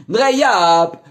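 shouted name backwards; backwards speech. Acted. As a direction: yell it in reverse. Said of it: "rückwärts".

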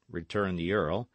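plain reading voice

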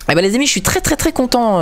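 very high, delighted exclamation; excited high voice. Real, not acted. Gamer delighting his viewers, high excited voice. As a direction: squeal with joy over the episode.

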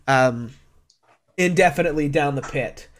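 normal talking voice

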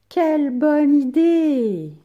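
loud, slow, delighted cry; enthusiastic exclamation. Shown as a demonstration. Teacher reading the book's exclamation aloud, delighted. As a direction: exclaim "Quelle bonne idée!" with joy.

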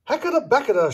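a witch's quick spell, muttered but pitched high; mumbled spell. A narrator's reading. Narrator voicing the witch casting a spell, mumbled incantation. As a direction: rattle off the magic words under your breath. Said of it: "she mumbled".

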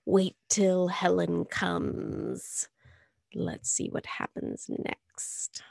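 hushed spooky whisper. Acted. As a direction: lean in and whisper the tease. Spooky aside to listeners.

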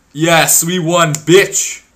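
loud, high victory yell; real triumphant yell; triumphant shout. Real, not acted. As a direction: gloat loudly at the win. Celebrating the win.

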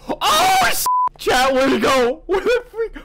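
very high, loud frantic yelling; panicked shouting. Real, not acted. Streamer panics when Shrek disappears, shouting. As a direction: yell in real alarm.

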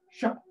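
short imitated frog-tongue snap; tongue-snapping noise. Shown as a demonstration. Storyteller showing frog catching mosquitoes, abrupt snap noise. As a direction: make a quick catching sound. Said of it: "los atrapaba así".

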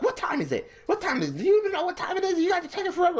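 very high-pitched mock teacher nagging; teacher with attitude. Teacher scolding a student for taking forever, high mock attitude. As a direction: imitate a teacher snapping in a squeal. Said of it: "the teacher was just getting an attitude".

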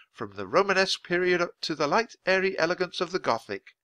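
bright, friendly tour guide; a lightly voiced, chirpy museum lady; cheerful museum guide. A narrator's reading.

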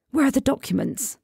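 high, urgent panicked question; panicked hiss. Acted. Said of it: "George hissed in a moment of panic".

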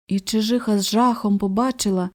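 ordinary delivery, nothing marked

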